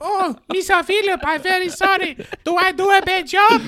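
put-on high, breathless Welsh girl voice; Welsh accent. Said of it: "That was a girl from Wales".

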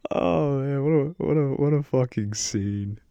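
helpless amusement easing into a slow finish; amused. Real, not acted. Host recovering from laughing at his own movie scene, amused. Cracking up after own table read.

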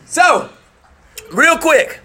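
usual speaking voice

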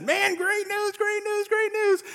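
a giddy, high usher shouting good news; thrilled usher. Retold or imitated. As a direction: burst in with great news.

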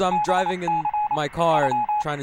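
high, punchy parody newscaster; mock breaking news. Podcaster spoofing a news bulletin while driving in traffic, high mock-newscaster voice. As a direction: announce it like an urgent bulletin.

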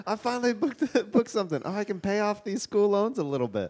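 high, laughing thrilled actor; elated actor voice. Retold or imitated. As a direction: squeal it out like a relieved actor. Acting out a struggling actor.

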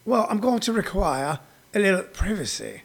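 soft, snooty British put-on; mock a British speaker, quietly prim; British accent. Comic imitating a British person requiring privacy, British accent. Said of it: "British person go".

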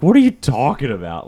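baffled protest, acting out your own reaction; incredulous. Retold disbelief at buddy's complaint.

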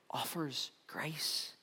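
slow soft whisper. Acted. Pastor closing the point on grace, slow whisper. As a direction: drop to a hush on grace. Quiet sermon climax.

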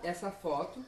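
solemn guru voice. Acted. Guru preaching unity.

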